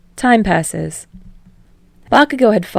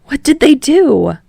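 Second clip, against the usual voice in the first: much higher, louder, slow question; eager curiosity; 8 semitones higher, 7 dB louder than usual. Acted. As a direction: ask with wide-eyed interest. Kirishima curious.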